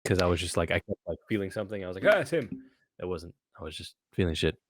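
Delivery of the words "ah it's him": startled. Retold or imitated. Feeling something at night.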